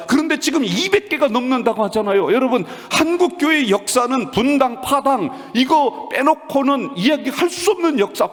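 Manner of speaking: high, loud, driving sermon delivery; impassioned preaching. Real, not acted. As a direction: preach it at full force on a sustained high pitch. Preaches at full force.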